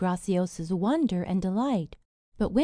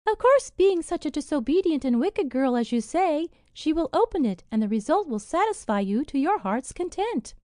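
higher, louder sly fairy voice; cunning fairy; 6 semitones higher, 4 dB louder than usual. A narrator's reading. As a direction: relish the wicked scheme. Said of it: "added the cunning fairy".

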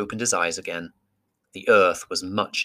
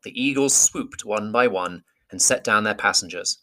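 ordinary delivery, nothing marked

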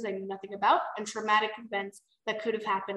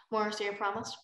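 ordinary delivery

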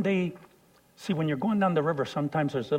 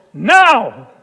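loud, urgent one-word order; shouted command; 7 semitones higher, 17 dB louder than usual. Retold or imitated. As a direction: bark it over the storm. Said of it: "And he goes".

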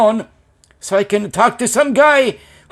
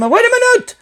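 mock high call. Quoting himself to the customer.

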